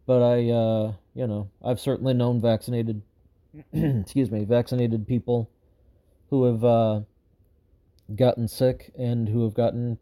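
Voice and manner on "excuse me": usual speaking voice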